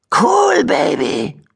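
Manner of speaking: high cheeky squeak on Cool; amazed high cheeky rat voice. Acted. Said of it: "staunte eine freche Stimme".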